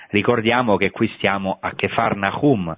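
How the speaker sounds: normal talking voice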